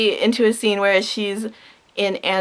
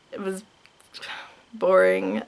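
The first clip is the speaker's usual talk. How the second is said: unvoiced bored whisper; 3 semitones lower, 5 dB quieter than usual. Real, not acted. Dismissing [name]'s song in a whisper. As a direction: breathe the word out, bored.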